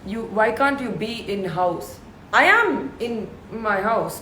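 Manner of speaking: ordinary delivery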